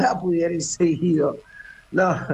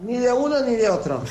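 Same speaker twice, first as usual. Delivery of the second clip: laughing; 8 semitones higher than usual. Real, not acted. Amused answer about giving data.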